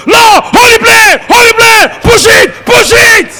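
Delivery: high, loud frantic pleading; panicked prayer shout. Retold or imitated. Narrator screaming at God inside the falling plane, frantic shout. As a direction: shout each order at heaven in fear. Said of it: "and I was saying".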